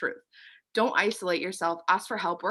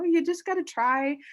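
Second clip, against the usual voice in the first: normal talking voice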